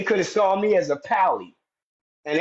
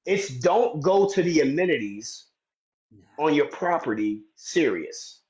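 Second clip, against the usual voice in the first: ordinary talk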